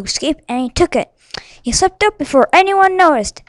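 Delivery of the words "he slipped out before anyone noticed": plain reading voice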